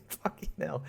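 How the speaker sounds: usual speaking voice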